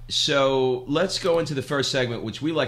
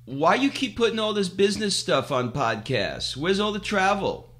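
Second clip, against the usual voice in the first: high-pitched mock questioner; goofy listener voice; 8 semitones higher than usual. Podcast listeners asking about travel, in a goofy high voice. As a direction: mimic a complainer, pitched up. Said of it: "I don't know why I made him sound that way".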